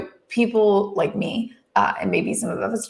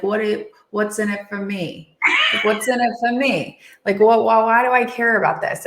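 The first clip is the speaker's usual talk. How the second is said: a bad mafia-boss accent, louder at the end; Godfather mobster accent; 4 dB louder than usual. Mobster boss asking what's in it for him, mock accent. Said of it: "That's a bad accent".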